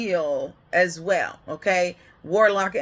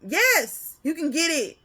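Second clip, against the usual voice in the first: much higher and louder, laughing yes; delighted God; 8 semitones higher, 4 dB louder than usual. Retold or imitated. Most High telling a child to take it, high and laughing. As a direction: cheer them on, gleeful. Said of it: "He's like".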